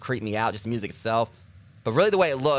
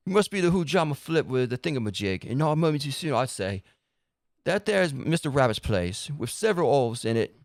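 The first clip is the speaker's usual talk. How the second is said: a lightly Cockney storybook character; light Cockney accent reading; 3 semitones higher than usual. Acted. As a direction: ham up a mild Cockney. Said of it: "now I can actually do this accent".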